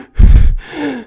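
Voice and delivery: high, loud gasp for air; breathless gasp. Retold or imitated. Girl out of breath from dancing, gasping loudly. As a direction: gasp like someone worn out from dancing. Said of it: "मतलब सांस चढ़ गई उसको".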